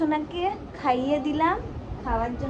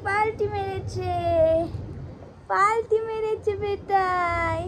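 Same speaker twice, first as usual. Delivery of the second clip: slow, higher, drawn-out cheer; delighted; 7 semitones higher, 3 dB louder, slower than usual. Real, not acted. Baby rolled over, delighted.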